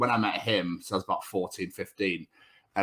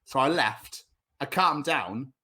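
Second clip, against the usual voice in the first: normal talking voice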